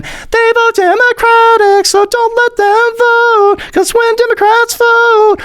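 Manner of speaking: very high, drawn-out squeal; mock panicked Republican. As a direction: wail it slowly in a silly high voice. Mocking Republican fear of Democrat votes.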